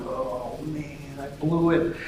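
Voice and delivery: hushed, deflated self-reproach; quiet acted regret; dejected mutter. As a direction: mumble it softly, kicking yourself.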